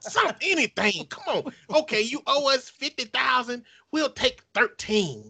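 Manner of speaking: high-pitched pleading haggle; desperate debt collector. Acted. Mock begging loan company haggles.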